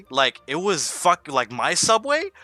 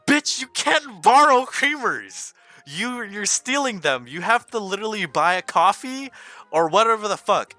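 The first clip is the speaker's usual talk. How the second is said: furious telling-off; 6 semitones higher than usual. Retold or imitated. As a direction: drag out each word in outrage. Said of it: "I was telling her off".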